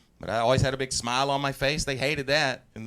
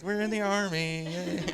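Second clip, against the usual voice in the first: pretending kids; 5 semitones higher, 4 dB quieter, slower than usual. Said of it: "like a bunch of kids at a sleepover".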